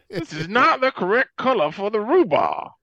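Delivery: high, loud, affronted Southern gentility; hammed-up Louisiana complaint; mock Southern accent. Mock genteel Southern market shopper.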